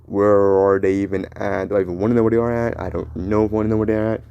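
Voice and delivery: normal talking voice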